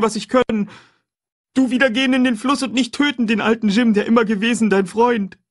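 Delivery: high, loud, pleading terror; frightened man on his knees begging a ghost for mercy; stammering in terror. A narrator's reading. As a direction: beg for your life, voice cracking high.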